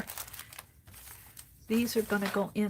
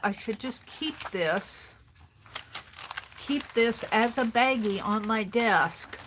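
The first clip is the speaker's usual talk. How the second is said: normal talking voice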